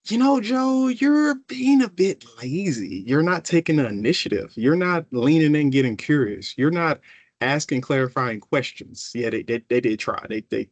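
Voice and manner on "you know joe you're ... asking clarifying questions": critical teammate. Retold or imitated. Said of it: "my teammate's gonna say".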